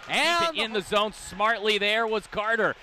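ordinary talk

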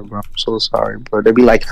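ordinary talk

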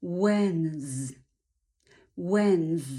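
"Wens" ending in a held, buzzing z; hard Z ending. Shown as a demonstration. Teacher builds Wednesday's first syllable, hard final Z. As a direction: when plus a hard z in "Wens". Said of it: "dodajemy do pierwszej sylaby when twarde Z".

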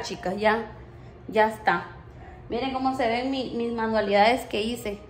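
normal talking voice

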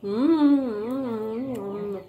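chewing while talking; talking around food; mouth full. Real, not acted. Seen 0: left speaker bites meatball, chews and talks throughout.

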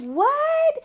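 high, stunned "What?"; shocked disbelief, jumping up high; amazed what. Retold or imitated. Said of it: "I was like".

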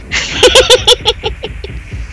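laugh. Real, not acted. Laughing at joke.

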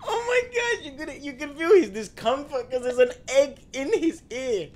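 high and loud, talking through laughter; giggling retelling; laughing. Real, not acted. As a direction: barely get it out for laughing. Retelling a funny video.

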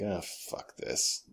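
slow, near-whispered exasperated aside; fed-up mutter. Retold or imitated. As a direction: mutter it under your breath, giving up. Acts out giving up mid-game.

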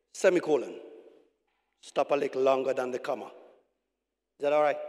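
normal talking voice

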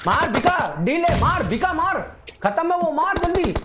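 high-pitched frantic cheering on; excited urging. Real, not acted. Player yelling at Bhika to finish him, frantic. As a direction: urge your teammate on, voice shooting way up.

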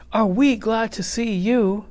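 high, excited schoolchild voice; glad kids' cry. A narrator's reading. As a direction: greet the teacher with high-pitched relief and joy. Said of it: "cried the kids".

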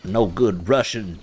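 commander voice. Acted. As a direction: growl it like the commander. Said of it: "I'm just doing my commander impression".

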